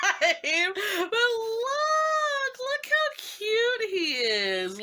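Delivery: high, slow swooning; squealing with delight; gushing. Real, not acted. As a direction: coo over how cute he is.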